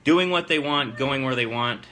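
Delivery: ordinary talk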